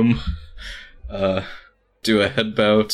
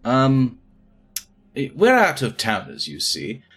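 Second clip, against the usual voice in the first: Cecil, rambling thief; 2 semitones higher than usual. Acted. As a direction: babble nervously like a country bumpkin in over his head. Player holds Cecil's voice.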